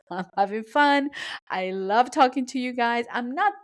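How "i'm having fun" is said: laughing through it, light and playful. Real, not acted. Host laughing, explaining her pitchy voice to guests. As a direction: let a real laugh carry the words. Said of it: "I sound pitchy because I'm having fun".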